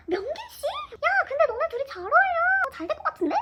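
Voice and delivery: root for her loudly; cheering friends. Retold or imitated. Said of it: "엄청 응원을 해줬어요". (친구들이 신나서 응원하는 목소리)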